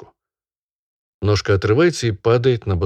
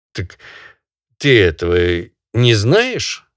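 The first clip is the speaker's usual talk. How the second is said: stern barin; 4 semitones higher, 5 dB louder, slower than usual. Acted. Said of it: "спрашивает барин".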